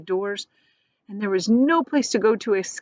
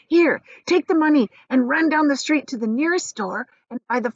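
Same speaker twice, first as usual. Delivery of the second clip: higher, eager urging; excited old grandpa; 7 semitones higher than usual. Acted. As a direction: hurry the boy along.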